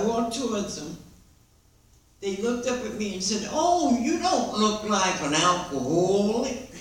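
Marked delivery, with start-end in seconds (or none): 3.5-6.7 slow mimicry of the girls at the meeting; mimicking the girls. Girls greeting a newcomer at her first meeting, slow mimicry. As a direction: drag it out the way the girls said it. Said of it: "I'm only mimicking the girls".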